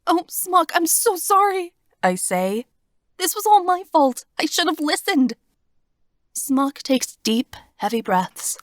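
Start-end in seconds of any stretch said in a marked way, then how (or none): 0.1-1.7 high, tearful apology. Acted. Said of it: "Tears start pouring from my eyes". 3.2-5.3 high, tearful guilt; tearful self-blame. Acted. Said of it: "Tears start pouring from my eyes".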